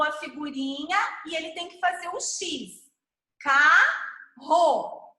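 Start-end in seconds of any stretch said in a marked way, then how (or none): 3.4-5.1 "Carro" split in two, ca, rro, with a gap; each syllable of "Carro" held apart. Shown as a demonstration. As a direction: break "Carro" into separate beats. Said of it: "brincadeira de segmentação silábica".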